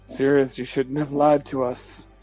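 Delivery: usual speaking voice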